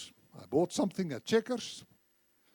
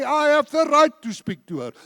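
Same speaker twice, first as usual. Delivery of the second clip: higher and louder, driving it home; preacher insisting on his right to speak, louder; emphatic repeat; 8 semitones higher, 7 dB louder than usual. Real, not acted. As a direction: preach it with force, pushing harder. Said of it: "I say".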